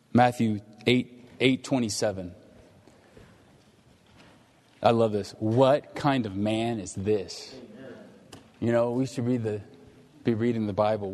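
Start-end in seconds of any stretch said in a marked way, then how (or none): 5.4-7.5 slow, weighty quote. Acted. Preacher quoting a verse from Matthew, slowly and weightily. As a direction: draw out each word slowly, with weight.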